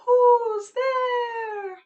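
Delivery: high, loud owl hoot with drawn-out vowels; owl calling out. Acted. Teacher voices the song's owl calling out, owl call. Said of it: "there's an owl calling out".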